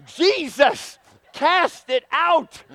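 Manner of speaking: high, slow churchy sister voice, lightly put on; mock church lady. Preacher mimics a woman in the pews, slow and high. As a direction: imitate a fervent sister in church. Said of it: "She's like".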